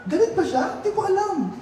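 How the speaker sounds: high, laughing mock reply. Mimics oblivious person laughing it off. (tumatawang walang-malay na sagot)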